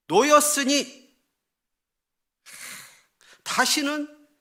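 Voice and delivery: loud, high, stretched-out cry; forceful proclamation. Acted. Preacher quotes Israel refusing to return, forceful proclamation. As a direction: cry the words out emphatically.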